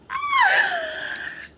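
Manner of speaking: high, shrieking burst of laughter; a shocked scream-laugh. Real, not acted. Shocked at suspicious charge.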